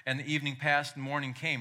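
ordinary talk, nothing marked

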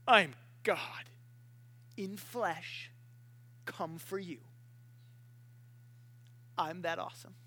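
low, soft, self-important announcement; understated grand reveal; mock lecture. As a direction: deliver it in measured pieces with pauses. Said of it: "big lecture going".

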